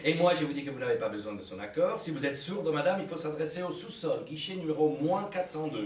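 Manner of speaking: loud, fast, scornful; angry. Acted. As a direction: snap at her like a rude clerk. Clerk angrily insulting client.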